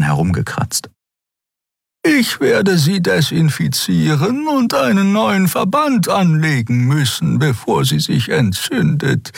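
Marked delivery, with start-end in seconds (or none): 2.0-9.3 high-pitched, measured caregiver voice; insistent female character. A narrator's reading. Woman insists on treating the wound.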